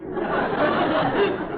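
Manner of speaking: breathless embarrassed titter; high, airy flustered laugh; nervous laugh. Acted. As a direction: giggle away the awkward moment. Flattery blurted out on phone.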